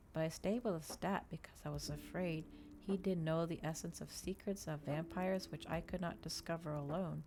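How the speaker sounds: plain reading voice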